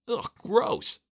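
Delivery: high-pitched cry of disgust; grossed out, voice jumping up. Acted. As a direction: recoil as from a moldy bite. Disgust at biting moldy sandwich.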